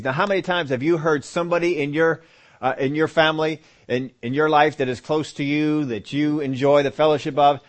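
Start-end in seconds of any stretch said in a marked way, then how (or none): none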